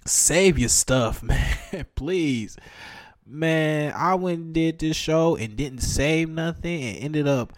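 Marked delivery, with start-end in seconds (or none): none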